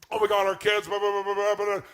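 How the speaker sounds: loud mock fret about the kids; loud mock rant about the kids; mock panicked parent.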